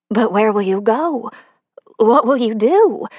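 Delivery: cling to him with questions; wife begging him to stay; pleading Sonia. A narrator's reading.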